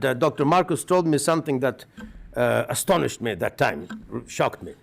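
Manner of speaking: usual speaking voice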